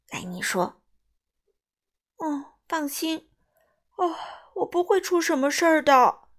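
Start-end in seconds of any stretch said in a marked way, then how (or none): 2.1-6.3 yawning. A narrator's reading. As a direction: reassure someone through big yawns. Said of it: "米歇尔打着哈欠". (打着哈欠说话)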